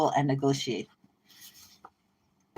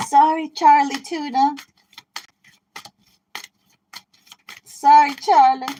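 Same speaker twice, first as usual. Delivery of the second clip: mock taunt; 9 semitones higher, 7 dB louder than usual. Sing-song taunt.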